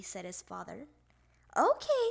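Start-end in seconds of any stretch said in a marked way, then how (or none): 1.6-2.1 high, loud, eager reply; little frog raring to go; eager agreement. A narrator's reading. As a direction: pipe up high and keen. Froggy agrees eagerly to get ready.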